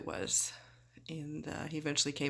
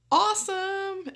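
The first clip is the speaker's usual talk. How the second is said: awesome; 5 dB louder, slower than usual. Real, not acted. Sarcastic reaction to warm blanket.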